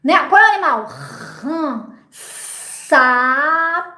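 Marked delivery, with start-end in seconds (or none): 1.4-1.9 softer "Rã" with a lengthened R; quiet, stretched-out "Rã"; sounded out in pieces. Shown as a demonstration. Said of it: "Vou dizer o nome de um animal aos pedacinhos". 2.9-3.9 "Sapo" stretched out sound by sound; long hissed S in "Sapo", held vowel, then "po"; sounded out in pieces. Shown as a demonstration. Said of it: "você vai falando pedacinho, sonzinho".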